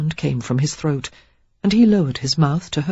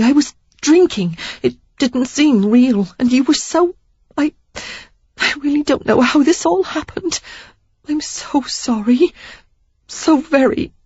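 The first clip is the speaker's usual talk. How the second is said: desperate apology; 10 semitones higher than usual. Acted.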